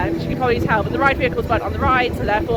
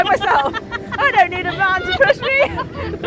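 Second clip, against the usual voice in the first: laughing; 8 semitones higher, 3 dB louder than usual. Real, not acted. Laughing joke about waltzer.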